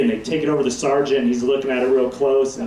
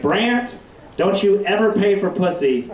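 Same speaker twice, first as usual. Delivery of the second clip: put-on higher, earnest old grandma voice; grandma's warning; 5 semitones higher than usual. Grandma warning her thirteen-year-old grandson, higher voice. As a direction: play grandma pulling him aside. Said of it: "she was like".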